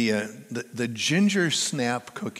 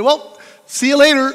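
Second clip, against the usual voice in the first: high, louder bright farewell, lightly imitating the runner; cheery goodbye; 13 semitones higher, 11 dB louder than usual. Young runner calling goodbye as she speeds away, cheery. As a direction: call it out as she runs off.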